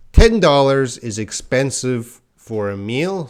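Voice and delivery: normal talking voice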